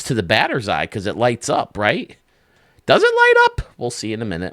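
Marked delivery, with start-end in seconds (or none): 2.9-3.6 excited question. Real, not acted. Wondering if building lights up.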